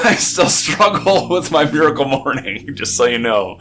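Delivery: laughing through the words. Real, not acted. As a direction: giggle while confessing. Laughing while admitting struggle.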